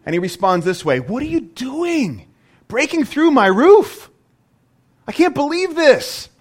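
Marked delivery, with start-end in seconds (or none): 1.0-6.3 higher and louder, slowed and hammed-up indignation; mock outraged outburst. Preacher mocks an outraged Jesus at the roof, hammed-up indignant yell. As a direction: play an imagined Jesus as shocked and offended. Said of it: "No, he doesn't do that".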